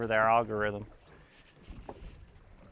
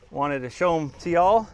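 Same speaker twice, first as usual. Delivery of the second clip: usual speaking voice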